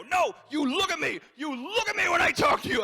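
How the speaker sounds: high, furious re-enacted shouting; yelling. Comic telling off a shamed heckler, high angry yelling. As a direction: demand attention at full voice. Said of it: "As I'm yelling at him".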